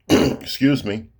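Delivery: lightly voiced dog politely getting attention; talking dog. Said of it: "Then the dog goes".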